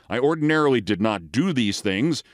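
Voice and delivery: plain reading voice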